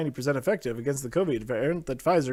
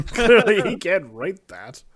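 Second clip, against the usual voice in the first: laughing repeat; 3 semitones higher, 4 dB louder, slower than usual. Real, not acted.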